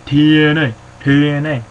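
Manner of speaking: loud announcer-style TNA callout; hype shout. Real, not acted. Hyping his favourite show with a loud callout.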